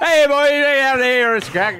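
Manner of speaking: high, loud, laughing Irish impression; Irish accent. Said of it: "Now call into an Irish accent".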